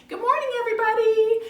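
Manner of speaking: high, loud cheery greeting; hammed-up morning enthusiasm; good attitude. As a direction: greet the whole house with cheer. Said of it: "when you have a good attitude".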